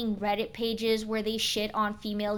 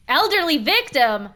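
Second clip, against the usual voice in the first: startled; 5 semitones higher, 9 dB louder than usual. Real, not acted. Startled by game.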